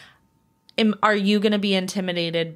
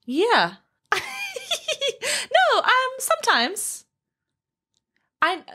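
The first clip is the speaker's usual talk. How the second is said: laughing confession; slower than usual. Real, not acted. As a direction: own up with a laugh. Caught out by a pointed question.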